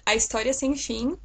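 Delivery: "A História Sem Fim" in native Brazilian Portuguese pronunciation; Brazilian Portuguese title. Shown as a demonstration. Showing a viewer how the title sounds, "A História Sem Fim" in Brazilian Portuguese. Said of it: "she would like to know how this Brazilian titles sound".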